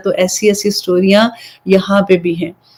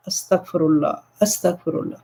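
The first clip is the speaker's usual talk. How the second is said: soft, low, dismayed murmur of astaghfirullah; hushed astaghfirullah; 4 semitones lower, 10 dB quieter than usual. Real, not acted. Vlogger recoiling at the mother's eviction, hushed murmur. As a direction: breathe it out under your breath in disbelief.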